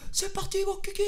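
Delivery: high, sing-song whoop; thrilled pilot shouting let's go; gleeful cheer. Retold or imitated. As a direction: launch it like a cheer. Excited launch on the motorway.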